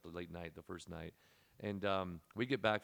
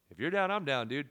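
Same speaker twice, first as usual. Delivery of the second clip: eager agreement; 7 semitones higher, 12 dB louder than usual. Retold or imitated. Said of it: "I'm like".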